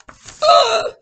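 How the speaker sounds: very high startled gasp; loud storyteller gasp; big gasp. Acted. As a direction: gasp in alarm for the kite. Kite spins away.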